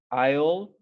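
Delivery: I'll said as one syllable, like "aisle"; teacher's pronunciation demo. Shown as a demonstration. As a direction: model I'll carefully for the class as "ail". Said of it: "se pronuncia I'll".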